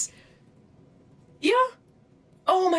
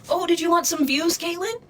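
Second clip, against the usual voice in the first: mocking taunt; 9 semitones higher than usual. Real, not acted. Taunting the harasser directly.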